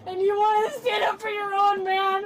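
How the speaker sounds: sobbing accusation; tearful, wounded demand; crying. Real, not acted. Arrested woman sobbing at deputies.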